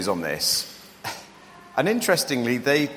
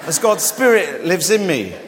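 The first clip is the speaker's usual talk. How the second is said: loud, raised superhero declaration; mock dramatic; 5 semitones higher, 5 dB louder than usual. Preacher jokingly reveals himself as Superman to the congregation, mock dramatic. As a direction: proclaim it big, hammed up for laughs. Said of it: "joking for dramatic effect".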